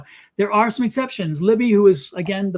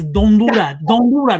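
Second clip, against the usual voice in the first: louder, punching each word of the warning; insistent warning; 7 dB louder, slower than usual. Real, not acted. Medium scolding Lisa to stop blocking spirits, insistent and loud. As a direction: drive the point home, don't do that. Repeats warning, look at my face.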